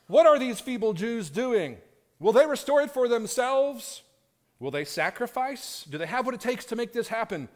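loud mocking sneer at the builders; sarcastic jeering. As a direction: jeer like a schoolyard bully. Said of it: "full sarcastic jerk mode".

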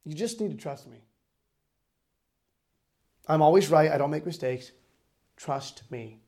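low, measured voice of God; calm authority, unhurried with long pauses; calm reassurance. Retold or imitated. God's calm answer to upset prophet.

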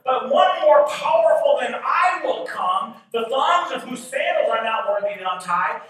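high, loud preacher proclaiming Scripture; full-force preaching. Acted. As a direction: preach it at a shout, pitched high.

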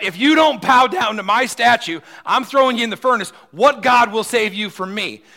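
loud, high roaring tyrant; furious king. Acted. Tyrant king roaring at the three Hebrews. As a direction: bellow the threat like a raging king. Preacher voicing Nebuchadnezzar's threat.

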